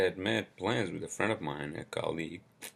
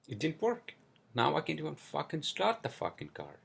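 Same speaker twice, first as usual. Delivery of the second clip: pissed-off rant; 8 semitones higher than usual. Pretend. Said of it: "So I'm, I'm kinda pissed".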